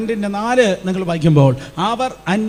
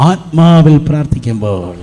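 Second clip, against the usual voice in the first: emphatic answer; 3 semitones lower, 6 dB louder than usual. Real, not acted. Preacher gives the answer.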